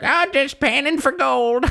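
put-on high, drawn-out old-timer voice; skinny little hobo. Hobo answering Arthur, high put-on voice. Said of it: "like, a skinny little hobo".

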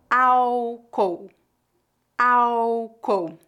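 Álcool with the final L said as U, awkow; slow, clear Álcool, L becomes U; pronunciation demo. Shown as a demonstration. Teacher modelling Álcool for learners, slow and clear. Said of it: "Atenção à pronúncia".

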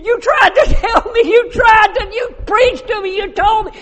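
anguished crying shout. Retold or imitated. Grieving father cries to preacher.

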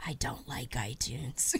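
hushed confession, low and breathless; whispering. Real, not acted. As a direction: whisper it like a guilty secret.

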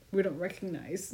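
laughing. Retold or imitated. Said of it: "And I was like".

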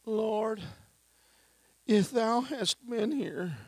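high, slow whining plea; whining Mary. Acted. Preacher acts Mary whining to Jesus, high and slow. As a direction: whine it like a hurt sister, pitched high. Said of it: "She whined".

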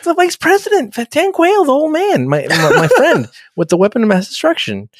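high mock explanation breaking into laughs. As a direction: squeak it out like a flustered fool. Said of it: "he's like".

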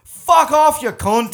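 loud, high Irish outburst; mock-angry Irish yell; Irish accent. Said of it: "when a real Irish guy goes".

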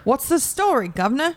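cheeky, exaggerated Cockney; Cockney impression. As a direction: do a mock Cockney greeting. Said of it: "It's Cockney".

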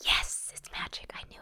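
excited whisper; breathless hushed triumph. Real, not acted. Player excited about magic necklace.

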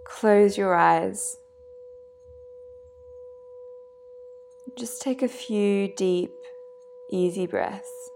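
guided meditation. Acted. Yoga nidra guide leading listeners to let go, guided meditation. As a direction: guide them into stillness, phrase by unhurried phrase.

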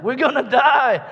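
very high, loud mock panic; panicked scream. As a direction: scream it like sailors in a storm. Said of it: "the disciples are like".

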